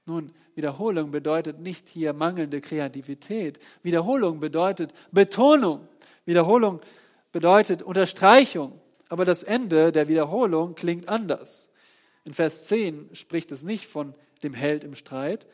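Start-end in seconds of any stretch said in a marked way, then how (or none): 3.8-11.7 loud, rising sermon build; fiery pulpit climax; full-force preaching. Acted. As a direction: preach it out, higher and louder.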